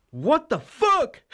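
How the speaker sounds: high, slow, drawn-out cartoon dog voice; a dog gasping in shock, high-pitched; dog's shocked voice. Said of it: "she fucking looks up and goes".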